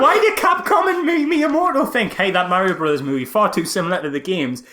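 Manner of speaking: high, loud incredulous ranting; worked-up film rant; exasperated rant. Real, not acted. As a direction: vent your disbelief. Ranting about the Mario movie.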